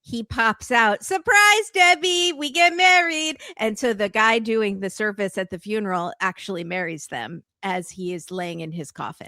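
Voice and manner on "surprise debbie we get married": high, loud, hammed-up announcement; gleeful surprise shout. Groom popping out of the casket, gleeful shout. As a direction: burst out with a big reveal.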